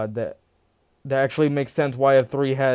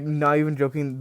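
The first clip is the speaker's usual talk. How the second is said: ordinary delivery, nothing marked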